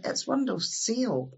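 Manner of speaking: "It's windowsill" slow, in a mock English accent. Visitor from England corrects him, slow mock English accent. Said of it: "they'll say".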